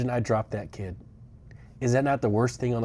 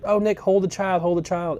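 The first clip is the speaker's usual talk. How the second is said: lightly imitated eager girls, higher pitch; retold chorus of urging, pitched up; 7 semitones higher than usual. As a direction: coax in a higher mock-girl voice. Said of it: "all the girls were like".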